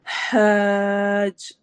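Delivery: حاج with the long alif stretched very slowly, throaty ha; slow recitation of حاج for the class; elongated madd. Shown as a demonstration. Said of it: "ح حروف حلقی میں سے ہے".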